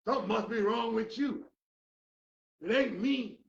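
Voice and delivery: fiery pulpit build; preaching at full force. Acted. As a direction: drive each why home to the crowd.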